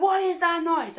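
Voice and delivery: high, loud panicked question; retold freaking out. Scrambling for glasses as friend laughs, freaking out. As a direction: act out panic at a mystery noise. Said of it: "I'm literally freaking out".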